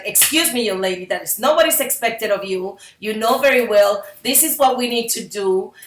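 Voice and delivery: loud stern scolding mother; stern mom. Retold or imitated. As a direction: scold her firmly and loudly, all out. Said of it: "I am go all out".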